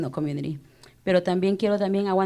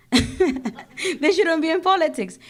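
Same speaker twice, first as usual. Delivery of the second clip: slow, higher and laughing through it; 9 semitones higher, slower than usual. Real, not acted. To the crowd, repeating her jab, laughing. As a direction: laugh it out, amused at her own point.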